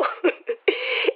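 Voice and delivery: brief amused breathy laugh; short laugh. Acted. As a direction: laugh it off quickly. Laughing at Mario story. (risa breve)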